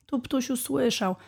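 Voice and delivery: usual speaking voice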